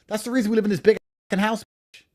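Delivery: ordinary delivery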